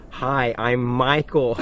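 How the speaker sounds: very slow, deliberate name-giving; imitating someone talking carefully to an automated phone line; phone-menu enunciation. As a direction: spell it out slowly for a voice recognition system. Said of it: "vocal recognition kind of things".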